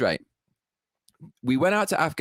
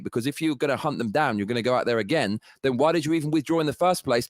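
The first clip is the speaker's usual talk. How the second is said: ordinary talk, nothing marked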